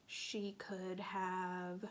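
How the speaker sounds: ordinary delivery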